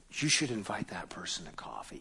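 hushed, low near-whisper; little inner voice. Acted. Inner voice nudging a believer to invite someone, hushed. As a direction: whisper it like a private prompting. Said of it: "a little voice that sounded suspiciously like your own".

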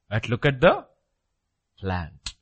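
normal talking voice

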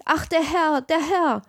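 maid's cry. A narrator's reading. Maid finding the master in the smoke, high mock cry. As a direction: lament in a high, distressed voice. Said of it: "das Dienstmädchen schrie".

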